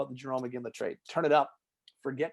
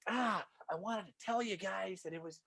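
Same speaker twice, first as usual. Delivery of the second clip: a lightly voiced, higher sheepish confession; Craig Conroy; 6 semitones higher than usual. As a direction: sound guilty, like the new guy in management. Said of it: "He's like".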